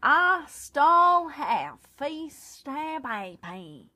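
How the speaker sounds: slow careful reading. Shown as a demonstration. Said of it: "Are we saying the ah wrong?".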